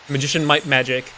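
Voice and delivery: ordinary talk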